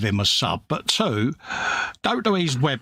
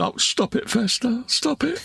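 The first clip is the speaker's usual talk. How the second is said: high, breathy mock sob rising higher; mock tearful plea; 10 semitones higher than usual. Host imitates Fred getting emotional over praise, mock weepy. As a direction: pretend to well up and beg him to stop. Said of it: "Fred's now welling up".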